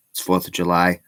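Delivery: talking while eating sushi; mouth full. Real, not acted. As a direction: say it round a mouthful. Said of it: "Shouldn't talk with my mouth full".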